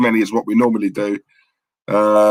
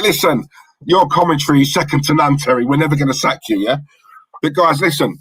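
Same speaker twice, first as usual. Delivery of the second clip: ordinary talk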